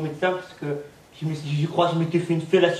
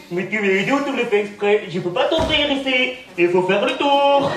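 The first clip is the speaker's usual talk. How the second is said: mocking retort; 6 semitones higher, 5 dB louder than usual. Taunting the angry neighbour.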